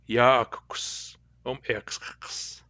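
very slow repeat of ya akuks um iksks in Cayuga dialect; slow Cayuga dialect echo. Shown as a demonstration. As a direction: say it again slowly, Cayuga dialect. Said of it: "In Cayuga dialect".

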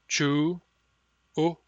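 syllables said apart; syllable drill. Shown as a demonstration. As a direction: split it into syllables. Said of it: "By syllables".

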